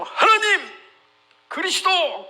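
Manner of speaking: high, acted call to God; crying out. Showing how Hezekiah should have prayed, crying out high.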